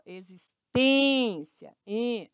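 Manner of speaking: existência slow, stretched on the closed ê. Shown as a demonstration. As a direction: draw out tên in existência, then repeat em.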